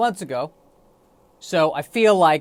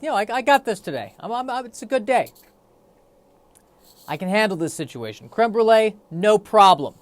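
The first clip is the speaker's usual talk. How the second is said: higher, fast, breezy bravado from the gut; cocky intestines; 4 semitones higher, 4 dB louder than usual. Retold or imitated. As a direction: voice the gut as an overconfident buddy. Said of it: "my intestines say".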